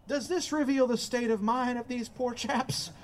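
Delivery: normal talking voice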